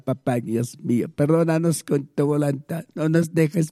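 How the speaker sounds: normal talking voice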